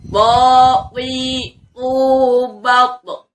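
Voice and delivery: loud, high drilled syllables; makhraj drill. Shown as a demonstration. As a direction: model each letter crisply for the class. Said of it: "kita ulang sekali lagi".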